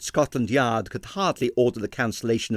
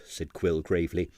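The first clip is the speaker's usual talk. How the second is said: ordinary delivery, nothing marked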